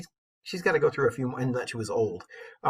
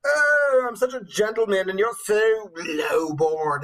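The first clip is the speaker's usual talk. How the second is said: mock knight; 3 semitones higher, 3 dB louder, slower than usual. Mocking the pompous knight husband.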